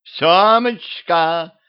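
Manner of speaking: high, long drawn-out wail; stretched lamenting cry. A narrator's reading. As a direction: wail a pet name from afar. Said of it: "заголосила Мария".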